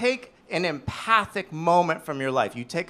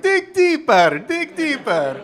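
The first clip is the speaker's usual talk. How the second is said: slow, much higher, loud mystical mentor intoning; Obi-Wan; 10 semitones higher, 7 dB louder, slower than usual. Retold or imitated. Comedian voicing Obi-Wan urging him, slow wise intoning. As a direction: draw it out like a wise Jedi master. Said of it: "it's like Obi-Wan going".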